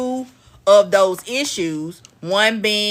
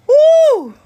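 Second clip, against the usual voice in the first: high loud whoop; loud delighted woo; excited whoop; 8 dB louder than usual. Real, not acted. Excited whoop mid-stream.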